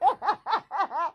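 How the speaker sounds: light real laugh; amused laugh. Real, not acted. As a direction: chuckle along.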